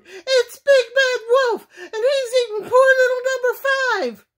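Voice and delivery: high, loud mother duck in a rage; hammed-up duck hysterics; enraged duck cry. A narrator's reading. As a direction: cry out in alarm, very high and fast. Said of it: "she cried".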